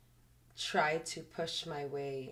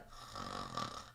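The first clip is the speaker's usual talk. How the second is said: mock snore gasp; 8 dB quieter than usual. Imitating fiance's fake snore gasp.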